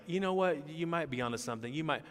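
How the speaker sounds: high, tentative mock reassurance; mock hedging. Preacher mimics what Jesus is not saying to Peter, mock hedging.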